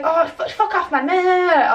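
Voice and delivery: high, whiny mimicking of her retort; mock her, dissolving into meh-meh-meh; mocking imitation.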